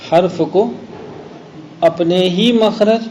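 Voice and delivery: slow dictation with pauses between phrases; definition for memorizing. Shown as a demonstration. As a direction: repeat it measuredly for students to memorize. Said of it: "اظہار کا یہ معنی یاد کریں گے آپ". (یاد کرانے کے لیے ٹھہر ٹھہر کر)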